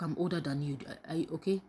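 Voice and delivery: ordinary talk, nothing marked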